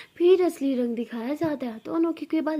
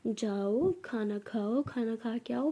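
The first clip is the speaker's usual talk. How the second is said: slow, soft, lowered coaxing; loving; 3 semitones lower, 6 dB quieter, slower than usual. Acted. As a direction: gently coax her as if she were a darling child. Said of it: "ऐसे बोलो ना".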